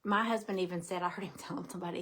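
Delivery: ordinary delivery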